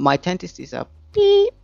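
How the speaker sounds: replace the swear with a sung-out beep; mock censor bleep. Shown as a demonstration. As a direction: beep voiced as a bleep sound. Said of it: "I missed the dirty words".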